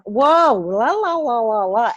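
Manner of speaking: loud gossipy blah-blah; mock backbiting chatter. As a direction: rattle off loud nonsense syllables as mock gossip. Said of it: "hear someone as soon as they're gone".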